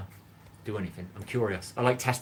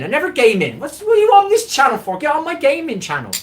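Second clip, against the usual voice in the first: high shrieking mock outrage, much louder; mock indignant squeal; 13 semitones higher, 9 dB louder than usual. Streamer mocks a chat comment in a squeaky outraged voice. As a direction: squeal it like an offended viewer. Mocking a viewer's broken English.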